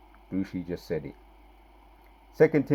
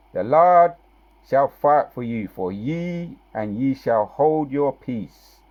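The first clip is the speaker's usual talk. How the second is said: preaching scripture; 3 semitones higher, 6 dB louder, slower than usual. Acted. Preacher proclaims scripture loudly.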